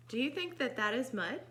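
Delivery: usual speaking voice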